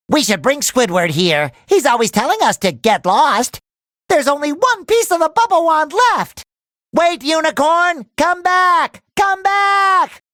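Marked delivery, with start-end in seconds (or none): 4.3-10.2 high, urgent calling out; calling after someone. Acted. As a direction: call after a runaway, pitch climbing. Calling after a fleeing unicorn.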